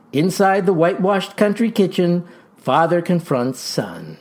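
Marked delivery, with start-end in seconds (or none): none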